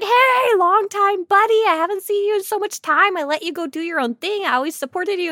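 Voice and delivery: high, bright-eyed friendly voice; overly sweet greeting. Retold or imitated. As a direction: gush over an old buddy. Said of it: "I was like".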